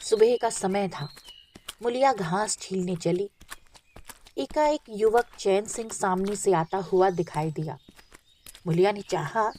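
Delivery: plain reading voice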